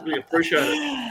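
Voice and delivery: mock gratitude. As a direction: overdo the gratitude as a tease. Joking thanks for embarrassing reminder.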